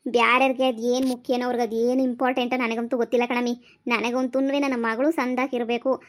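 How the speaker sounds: ordinary talk, nothing marked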